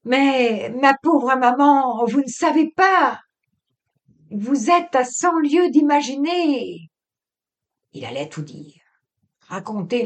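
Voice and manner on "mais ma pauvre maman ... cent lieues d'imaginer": higher, louder, slow indignant protest. Acted. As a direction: protest with outrage, drawing it out. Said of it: "Il leva des bras indignés".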